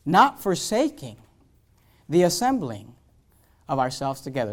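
slow, measured reading of not forsaking the assembling; slow scripture reading. Shown as a demonstration. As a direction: weigh each phrase of the verse. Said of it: "Hebrews ten twenty-five says this".

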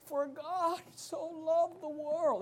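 high, soft, drawn-out fake weeping; slow hammed-up tearful recital; mock weeping, dramatic. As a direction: imitate a tearful, overdramatic quote. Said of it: "weeping, and she, like, gets all dramatic".

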